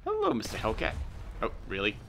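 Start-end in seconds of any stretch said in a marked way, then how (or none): 0.1-0.9 high sing-song greeting. Acted. Sing-song taunt to enemy tank.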